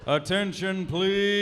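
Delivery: loud call to the room; calling out for attention. Real, not acted. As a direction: raise your voice to get attention.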